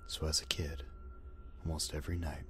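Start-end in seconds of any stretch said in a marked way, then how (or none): none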